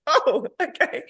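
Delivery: high, laughing mock reaction; amused retelling. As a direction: laugh through a high-pitched retold reaction. Co-host laughingly retells her reaction.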